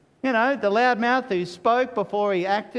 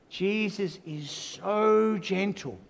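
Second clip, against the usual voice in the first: slow and softer, tender over the name; gentle hush; 4 dB quieter, slower than usual. Real, not acted. Preacher to his congregation, slow soft tenderness. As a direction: ease it out gently like a comfort.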